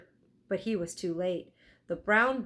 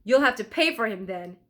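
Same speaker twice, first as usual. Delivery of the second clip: louder stern demand; barked order; 3 semitones higher, 7 dB louder than usual. Acted. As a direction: bark the demand at the boy. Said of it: "he barked".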